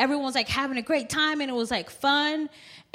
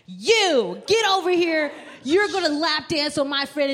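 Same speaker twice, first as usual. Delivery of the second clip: higher-pitched mock commanding; bossy demand; 4 semitones higher than usual. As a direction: order them around, hammed-up. Retelling her sudden bossy demands.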